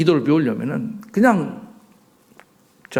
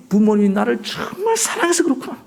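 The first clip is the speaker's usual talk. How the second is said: put-on child realizing; 5 semitones higher than usual. Preacher voicing a child who suddenly understands, gently touched. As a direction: let it dawn on you gently.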